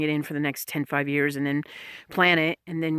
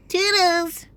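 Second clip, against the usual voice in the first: Toodles pushed even higher and louder; a squealed, higher Toodles as the final sign-off; louder playful goodbye; 14 semitones higher, 5 dB louder than usual. Pretend. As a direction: belt Toodles out in a squeaky high call.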